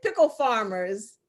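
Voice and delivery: usual speaking voice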